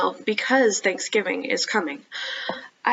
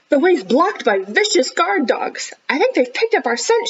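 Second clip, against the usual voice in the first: higher, urgent warning; mild yell; 7 semitones higher, 4 dB louder than usual. Acted. Rhonda warns Gavin about the guard dogs, higher and urgent. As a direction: sound the alarm about the dogs. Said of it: "she yelled".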